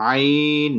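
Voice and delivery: Arabic letter আইন drawn out long, four alif length; Ain said long. Shown as a demonstration. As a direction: stretch আইন out as a model. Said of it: "এটা উচ্চারণ করতে".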